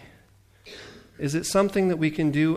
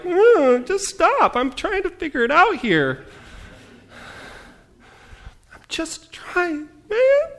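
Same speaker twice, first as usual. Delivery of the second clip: much higher, louder, strained squeak; exasperated plea; 5 dB louder, slower than usual. Retold or imitated. Sick preacher voices annoyance at askers, high and strained. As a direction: beg them to leave you alone. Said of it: "and I'm like".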